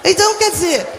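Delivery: loud, forceful emphasis; preaching shout. Real, not acted. Preacher insisting to her congregation, loud and forceful. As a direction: drive the point home to the crowd.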